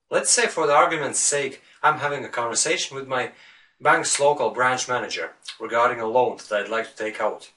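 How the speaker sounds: ordinary talk, nothing marked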